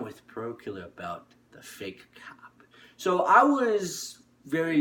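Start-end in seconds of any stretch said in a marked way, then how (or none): 0.0-2.6 whisper. Real, not acted. Whispered aside announcing the story.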